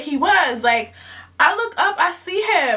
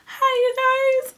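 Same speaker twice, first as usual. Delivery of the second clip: excited greeting; 9 semitones higher than usual. Real, not acted. Vlog greeting to fans.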